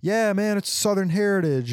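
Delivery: mildly mock their proud excuse. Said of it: "and they're like".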